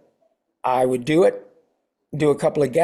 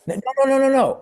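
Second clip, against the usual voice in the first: much higher, quick repeated no's; mock producers protesting; 10 semitones higher, faster than usual. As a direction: squeak the no's out high like eager producers. Producers' reassuring protest, mimicked.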